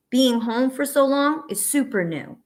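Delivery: usual speaking voice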